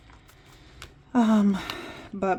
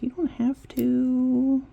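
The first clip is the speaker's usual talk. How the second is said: ordinary delivery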